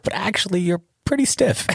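high mock voice of his friend; imitating Tiga. Mocking friend Tiga telling Seth he's stiff, high. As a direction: pitch it way up, teasing. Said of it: "He's like".

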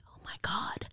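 put-on awed child's whisper. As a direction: breathe it in shocked wonder. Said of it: "And I went".

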